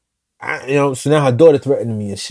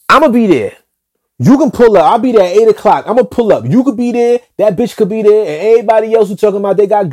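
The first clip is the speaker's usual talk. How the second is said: higher and louder, daring them to show up; threatening challenge; 6 semitones higher, 3 dB louder than usual. Retold or imitated. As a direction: throw down the challenge, loud and high. Issuing a threat over phone.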